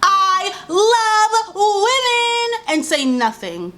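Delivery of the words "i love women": sung high and drawn out, mocking; mock the fake feminist in a high singsong; sarcastic singsong of a fake male ally; mocking sing-song. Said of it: "will claim".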